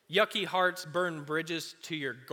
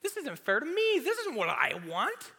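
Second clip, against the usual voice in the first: even higher, louder whine on "This isn't fair to me"; high, loud petulant tantrum voice; whinier complaint; 11 semitones higher, 3 dB louder than usual. Retold or imitated. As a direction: push the whine up into a squeal.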